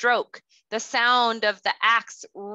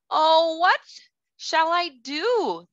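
high, pleading cry of dismay; cried out; 7 semitones higher, slower than usual. A narrator's reading. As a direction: wail it out in despair. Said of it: "the woodsman cried".

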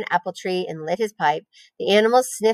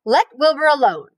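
higher, firm telling-off; sharp rebuke; 6 semitones higher, 4 dB louder than usual. A narrator's reading. Spider defending the pig from the lamb, sharp. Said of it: "she spoke sharply".

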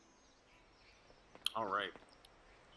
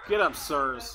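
louder, commanding get up; loud call; 8 dB louder, slower than usual. Real, not acted. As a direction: call them up with force.

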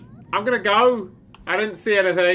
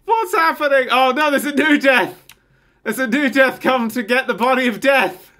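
excited; 4 semitones higher, 5 dB louder than usual. Real, not acted. New Death arrives, pissed.

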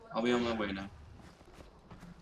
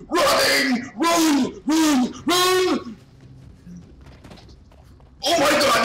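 high, loud shouts of real panic; screaming in alarm, pitched way up; panicked yelling; 11 semitones higher, 7 dB louder than usual. Real, not acted. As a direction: yell frantically as if fleeing for your life. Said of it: "sorry for my yelling".

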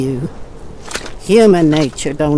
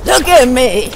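shout; 9 semitones higher, 7 dB louder than usual. Acted. Ranting monologue at the sky.

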